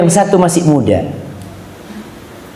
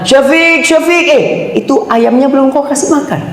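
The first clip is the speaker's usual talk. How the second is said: mother calling; 11 semitones higher than usual. Acted. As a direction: shout for your son across the room. Mother calling the ustadz mid-class.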